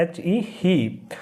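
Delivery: ही with a long ee; teacher sounding out ही with a long ee; spelling then saying he. Shown as a demonstration. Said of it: "बड़ी ई की मात्रा".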